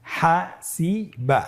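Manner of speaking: slow, syllable-by-syllable "হাসিবা"; pronunciation demo. Shown as a demonstration. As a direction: separate "হাসিবা" into drawn-out syllables. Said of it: "প্রথম উচ্চারণটি হলো". ("হাসিবা" ধীরে ভেঙে ভেঙে)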